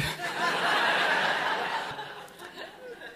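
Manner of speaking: long high bursting laugh; big laugh. Real, not acted. Storyteller laughs at the stranger's hotwire question, big laugh.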